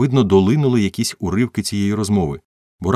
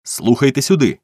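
louder, higher angry demand; fierce growl; 8 semitones higher, 6 dB louder than usual. A narrator's reading. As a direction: cut in fiercely to get his attention. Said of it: "люто прогарчав Том". (люто, з гарчанням)

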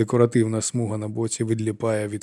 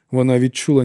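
ordinary delivery

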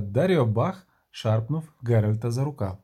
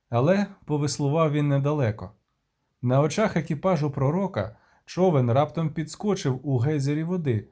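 usual speaking voice

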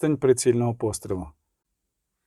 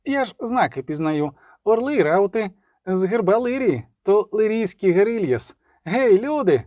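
high, insistent calling; eager cry, rising higher as it goes; excited shouting; 6 semitones higher than usual. A narrator's reading. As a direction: build from explaining to hailing people. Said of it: "крикнув Любисток".

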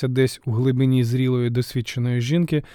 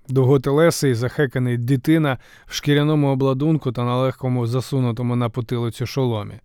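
plain reading voice

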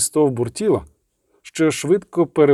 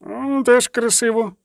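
high, slow, pleased musing; delighted academic musing; dreamy scholar; 10 semitones higher than usual. Acted. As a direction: savour the new name.

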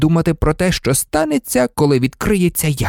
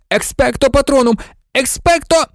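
desperate scream; 13 semitones higher than usual. A narrator's reading. Harry still screaming at the Dementor, desperate shriek. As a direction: shriek it as it fails.

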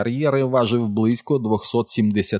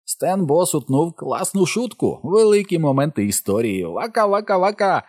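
high, hammed-up comic voice; milk it like a show-off comedian; clownish announcer; 9 semitones higher than usual. A narrator's reading. Richie clowns with a comic catchphrase.

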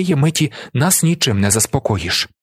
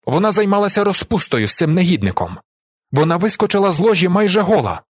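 high, heated accusation; indignant shouting; 7 semitones higher than usual. A narrator's reading. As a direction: denounce her before the whole room. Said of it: "кричить він".

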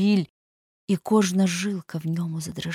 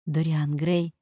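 plain reading voice

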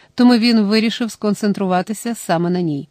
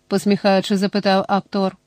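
ordinary delivery

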